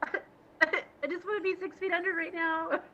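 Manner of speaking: slow, high voice breaking into laughter; embarrassed laughing. Retold or imitated. Retelling being scolded by a TV star, embarrassed laughter. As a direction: laugh helplessly through the mortification.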